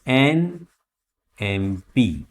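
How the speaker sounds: एनएमएलए spelled out very slowly; slow model reading. Shown as a demonstration. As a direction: stretch every letter of एनएमएलए. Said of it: "slow model reading".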